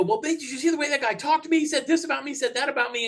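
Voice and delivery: high, fast, aggrieved complaint; mock-whiny retelling of a hurt young clerk; indignant younger self. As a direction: play your younger self whining to the boss. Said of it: "I go".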